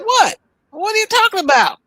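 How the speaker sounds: high, startled what, imitating the professor; mock surprise. Professor confronted by the school, feigning surprise. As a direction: play dumb, caught off guard.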